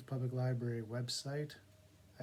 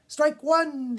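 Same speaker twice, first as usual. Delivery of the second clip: high, loud umpire bellow; umpire's call; 15 dB louder than usual. Acted. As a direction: call the strike across the field. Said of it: "the umpire said".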